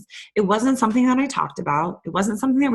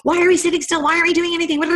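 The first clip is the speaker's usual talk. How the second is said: high, loud, impatient demand; exasperated outburst; 10 semitones higher, 5 dB louder, much faster than usual. Retold or imitated. On family vacation, complaining to family, loud exasperated outburst. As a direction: snap at the family, restless and fed up. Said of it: "I was like".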